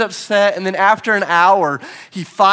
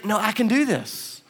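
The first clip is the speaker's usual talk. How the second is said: a hint of a determined toddler, higher; stubborn little boy; 4 semitones higher, 4 dB quieter than usual. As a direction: insist like a small kid who won't quit. Son Jack voiced insisting.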